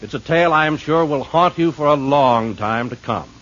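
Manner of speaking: usual speaking voice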